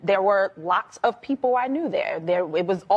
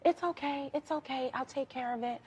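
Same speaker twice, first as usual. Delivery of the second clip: higher, much softer, gently calming; Janet's soothing murmur to a crying woman; 3 semitones higher, 11 dB quieter than usual. Retold or imitated. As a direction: hush someone who is panicking, tenderly. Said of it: "really soft voice that's like".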